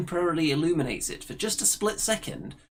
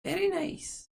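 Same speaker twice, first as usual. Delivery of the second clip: much higher crone voice; high old hag approving; slower than usual. Acted. Ethel praises her sister's plan, high crone voice.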